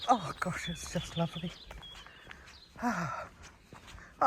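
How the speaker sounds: ordinary talk, nothing marked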